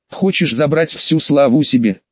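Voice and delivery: usual speaking voice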